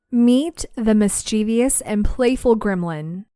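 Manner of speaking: ordinary talk, nothing marked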